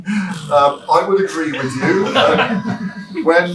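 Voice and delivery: laughing. Real, not acted. Designer laughing as he agrees with a listener, loud laughing. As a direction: let the laugh spill into the words.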